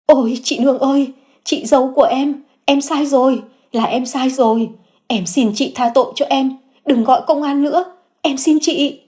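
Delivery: high, breaking plea for mercy; terrified sister-in-law begging not to call police; frantic pleading. A narrator's reading. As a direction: beg on your knees, panicked. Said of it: "hấp tấp quỳ gối van xin".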